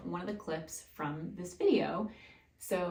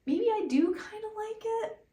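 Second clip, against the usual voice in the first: high-pitched, louder musing; retold second thought; 6 dB louder than usual. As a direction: voice a thought that is winning you over, pitched way up. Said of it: "and I thought".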